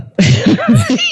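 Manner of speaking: high giggle; short high-pitched laugh. Real, not acted. Storyteller recalling her dilemma, giggling.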